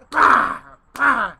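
loud, explosive "bah" thuds; two hard vocal bangs; imitated pounding. Storyteller half asleep in the tale hears banging, loud mimicked thuds. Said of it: "I hear someone pounding the wall".

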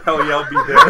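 laughing through a quote. Retold or imitated. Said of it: "And I go".

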